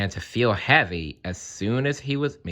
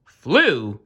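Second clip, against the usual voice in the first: a loud, incredulous "flew"; mock shout of surprise; 3 semitones higher, 5 dB louder than usual. A narrator's reading. Crows shouting back at the chief crow, incredulous. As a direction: toss it back in disbelief. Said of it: "shouted the others".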